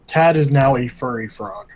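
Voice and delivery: ordinary talk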